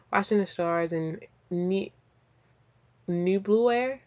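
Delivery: ordinary talk, nothing marked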